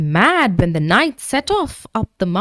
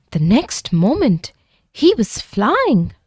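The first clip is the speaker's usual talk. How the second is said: usual speaking voice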